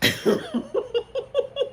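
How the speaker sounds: bright rising laugh; high laugh. Real, not acted. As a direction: burst out laughing. Laughs after ending recap.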